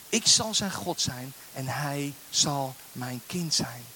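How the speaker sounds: slow, solemn Bible reading with rising intensity; scripture reading. Acted. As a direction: proclaim the vision slowly, then soften to a hush at the end. Reads Revelation passage aloud.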